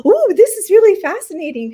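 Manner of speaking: high, loud fascinated gush; excited friend. Retold or imitated. Friend watching the scope on screen, thrilled gush. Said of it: "my friend was like".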